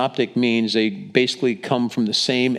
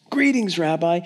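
high-pitched, gushing hail; hammed-up joyful welcome; effusive greeting; 6 semitones higher than usual. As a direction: greet him with showy delight, pitch way up. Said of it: "hypocrisy just written all over".

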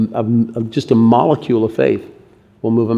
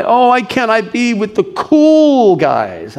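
high, drawn-out plaintive whine; left-out junior high kid; 10 semitones higher, slower than usual. Acted. Junior high outsider longing for the cool guys, high plaintive whine. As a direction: plead like an excluded schoolkid. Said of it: "or junior high".

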